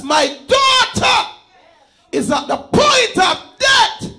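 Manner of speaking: high, pleading cry of a father; a father's high plea to Jesus; desperate father's plea. Acted. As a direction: beg for your dying child. Preacher voices Jairus pleading.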